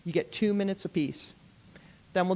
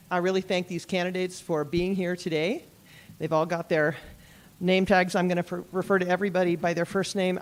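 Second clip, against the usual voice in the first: ordinary talk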